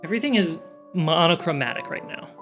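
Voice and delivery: ordinary delivery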